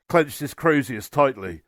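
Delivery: ordinary delivery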